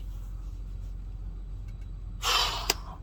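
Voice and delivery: long, heavy exhale; exasperated sigh. Retold or imitated. Man hearing about roommate's drugs, exasperated sigh. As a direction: let out a long, weary breath. Reaction to roommate's drug confession.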